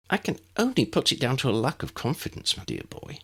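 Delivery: high, bright little wizard voice, kept up; a small, eager professor speaking; tiny professor voice. A narrator's reading. Puzzled Flitwick voiced.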